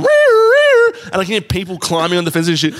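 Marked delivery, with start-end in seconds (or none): 0.0-0.9 high, loud wailing siren noise; siren imitation. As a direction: wail like an alarm siren. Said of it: "the sirens going".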